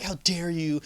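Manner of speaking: ordinary delivery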